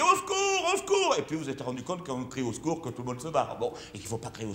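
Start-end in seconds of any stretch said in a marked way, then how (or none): 0.0-1.1 mock cry for help. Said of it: "vous avez crié".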